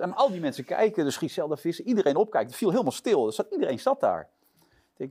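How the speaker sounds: ordinary talk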